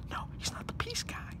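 whispering. Acted. Whispered aside.

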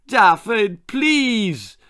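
high, loud exhausted screech; screeching mother. A narrator's reading. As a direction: screech his name in despair. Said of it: "screeched his exhausted mother".

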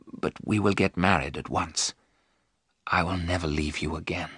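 low, soft murmured vow; hushed whisper. A narrator's reading. Jonathan promises Mina marriage at once, hushed and low. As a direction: promise it quietly, pitched low. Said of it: "Jonathan whispered".

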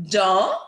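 "Dans" with its final S left silent. Shown as a demonstration. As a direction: say "Dans" ending on the vowel, no S sound. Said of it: "we don't pronounce the last letter".

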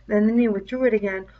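ordinary delivery, nothing marked

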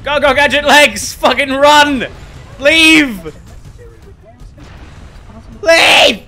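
high, loud frantic yelling; frantic shouting. Real, not acted. Gamer fleeing enemies, frantic high loud yelling. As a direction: shout it in a panic.

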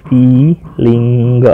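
"Feeling" very slow, split as "fee-ling"; stretched teaching version of "feeling"; slow syllable demo. Shown as a demonstration. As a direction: draw out both syllables of "feeling". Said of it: "આના ઉપર વધારે ભાર અપાશે".

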